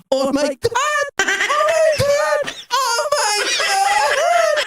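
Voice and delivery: mock ecstatic screaming. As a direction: ham it up like a climax. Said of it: "binabanatan siya ng mga nag-umaararo". (eksaheradong sigaw na may tawa)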